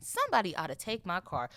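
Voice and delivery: usual speaking voice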